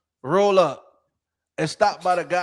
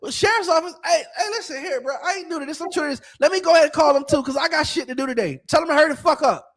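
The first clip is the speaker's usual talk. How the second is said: much higher, mock-cheerful taunt with a laugh; sing-song sarcastic dare; mocking sarcasm; 9 semitones higher than usual. Taunting the wife who called the sheriff, high mock-cheerful. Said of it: "I said".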